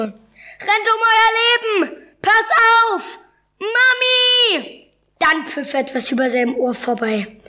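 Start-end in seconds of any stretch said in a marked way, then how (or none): 0.0-4.9 very high, drawn-out cries of alarm; panicked calls. A narrator's reading. Fleeing crowd shouting warnings from all sides, panicked calls. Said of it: "Von überall schallten Rufe".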